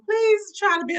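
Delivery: high, loud urging; emphatic plea. Real, not acted. Urging listeners to become audiobook narrators, emphatic high plea. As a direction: beg people earnestly, voice pitched right up.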